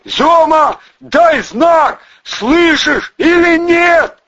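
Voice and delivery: high, loud, stretched-out call; hollering. A narrator's reading. Vovka begs his silent friend to signal, hollering. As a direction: bellow for a sign of life. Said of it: "орал Вовка".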